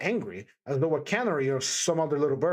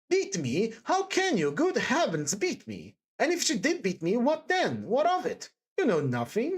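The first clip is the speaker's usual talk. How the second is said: higher-pitched, agitated character voice; pained, earnest protest; distressed Sonia, pleading; 7 semitones higher than usual. Acted. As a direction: defend her in swooping, emphatic bursts.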